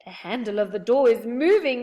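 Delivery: loud alarmed call; loud warning; yell. A narrator's reading. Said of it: "yelled Moonface".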